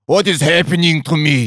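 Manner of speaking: thick mock Eastern European accent; hammed-up Eastern European porn-star accent. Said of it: "deep, thick Eastern European accent".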